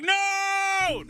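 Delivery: very high cartoon "No!"; squeaky orange shriek. Host retelling an ad, oranges' high cartoon yelp. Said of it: "They're like".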